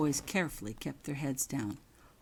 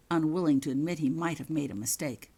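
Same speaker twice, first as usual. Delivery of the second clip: ordinary delivery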